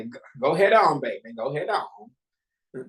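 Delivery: drawn-out doting granny, slowing down; sweet old grandma urging someone on. Retold or imitated. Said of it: "I use my grandma voice and say".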